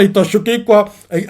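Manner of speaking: normal talking voice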